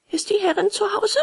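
high puppet voice calling upwards; high-pitched puppet's call. A narrator's reading. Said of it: "rief Bengele empor".

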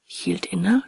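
ordinary delivery, nothing marked

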